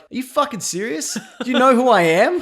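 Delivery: outraged disbelief, half laughing; incredulous outrage. Retold or imitated. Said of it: "Aquaman's just like".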